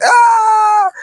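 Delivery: hammed-up cry of pain; mock yelp of pain. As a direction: yelp like a kid taking a swat. Classmate being paddled.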